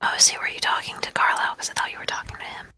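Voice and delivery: hushed, breathy whisper. Acted. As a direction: whisper so nobody overhears. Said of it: "'Cause we're fucking whispering".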